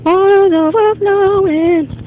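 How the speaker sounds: high, slow, far-off wail; high drawn alarm. Real, not acted. To the viewer about the overflowing water, a high slow mock wail.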